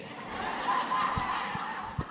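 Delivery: a high, airy burst of laughter; high breathy laugh. Real, not acted. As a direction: laugh hard at the punchline. Speaker laughs at own punchline.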